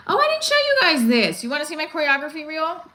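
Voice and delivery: suddenly remembering something to show; excited exclamation. Real, not acted. Telling viewers about her reel, excited.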